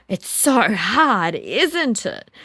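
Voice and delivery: slow, loud, drawn-out sympathy; empathetic acknowledgement. Retold or imitated. Mother to struggling toddler in foam pit, slow loud sympathy. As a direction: stretch it out to show you understand. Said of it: "And so I said to him".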